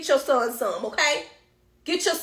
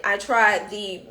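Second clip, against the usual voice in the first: normal talking voice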